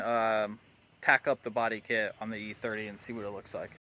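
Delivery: normal talking voice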